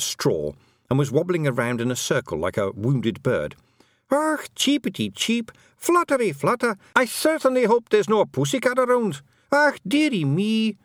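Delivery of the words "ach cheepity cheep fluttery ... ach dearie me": feagle yelling. Acted. Feagle baiting Ratbag, mock bird yell in high Scottish. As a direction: ham up the helpless little bird. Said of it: "it yelled".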